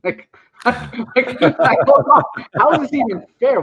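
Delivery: laughing, rising to a loud complaint; laughing protest. Real, not acted. Venting about early wake-ups, laughing and loud. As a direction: laugh out the outrage.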